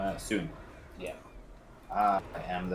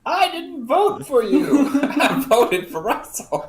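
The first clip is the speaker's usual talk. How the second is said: laughing; 12 dB louder, slower than usual. Real, not acted. Laughing at teammate's joke.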